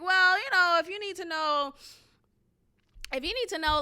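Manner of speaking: high mock tone, starting loud; imitated interviewer's tone. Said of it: "she's saying it in this tone".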